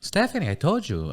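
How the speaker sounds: high, exasperated snap at her daughter; mom's annoyed high retort. Retold or imitated. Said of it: "She'll be like".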